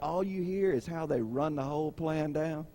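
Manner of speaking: ordinary delivery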